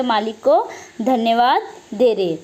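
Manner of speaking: ordinary talk, nothing marked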